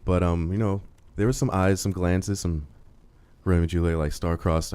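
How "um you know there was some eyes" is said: ordinary talk, nothing marked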